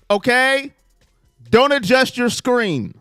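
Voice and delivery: ordinary talk, nothing marked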